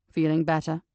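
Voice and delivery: low, steady man's voice; measured, deep detective voice; calm male detective. Acted. Joseph's voice.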